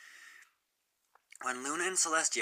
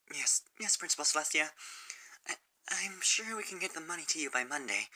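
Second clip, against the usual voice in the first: higher, nervous student reply; Sunset Shimmer; 6 semitones higher than usual. Acted. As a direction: stammer out a worried promise.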